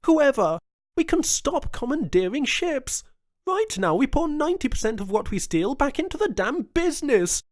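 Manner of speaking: high, loud, driving plea; rally him with passion; impassioned governor. A narrator's reading. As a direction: Jones arguing to stop the piracy, high and loud. Jones's long impassioned plea.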